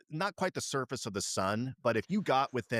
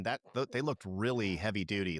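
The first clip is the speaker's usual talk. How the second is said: ordinary talk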